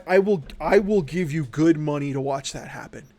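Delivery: ordinary talk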